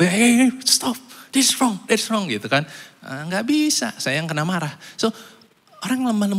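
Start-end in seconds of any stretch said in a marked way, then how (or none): 0.0-2.3 mock hero. Playing the would-be hero.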